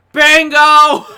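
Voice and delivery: loud, high yell of bingo; a bingo player yelling over the promo; shouted bingo call. Retold or imitated. Old lady in the crowd shouting bingo, loud.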